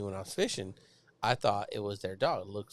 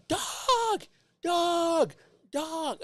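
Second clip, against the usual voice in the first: high-pitched hollered dog call, imitated; 5 dB louder, much slower than usual. Neighbours after their escaped dog, mock calling out. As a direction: mimic someone yelling after a runaway dog.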